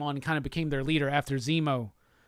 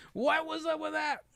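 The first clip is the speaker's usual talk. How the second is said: high-pitched incredulous question; much higher, puzzled self-mockery; baffled; 11 semitones higher, 3 dB quieter than usual. Real, not acted. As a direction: squeak it out, bewildered at yourself. Baffled at own failed accent.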